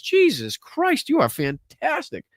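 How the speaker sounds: high, drawn-out stunned "Jesus Christ"; floored by the generosity; amazed exclamation. Real, not acted. Amazed at donor again.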